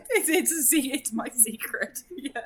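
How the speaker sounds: giggling through the words; sheepish giggly admission; laughing. Real, not acted. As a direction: laugh it out, a bit coy. Coy laughing over her secret.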